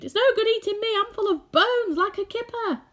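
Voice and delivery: high, loud bug voice; cheeky high centipede voice. Acted. Centipede bargaining with the attacker, high loud cheek.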